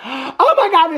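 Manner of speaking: high, sudden gasp of realization; gasping excitement. Real, not acted. Remembering a show comes out tonight, gasping excitement. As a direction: interrupt yourself with a thrilled gasp.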